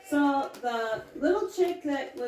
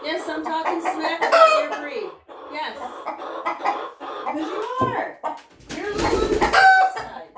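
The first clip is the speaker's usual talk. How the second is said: teasing pet voice; 7 semitones higher, 8 dB louder than usual. Real, not acted. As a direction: tease the rooster in a silly voice. Teasing the rooster Clyde.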